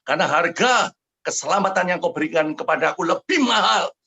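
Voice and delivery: impassioned retold prayer; fervent vow. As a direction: proclaim it with rising conviction.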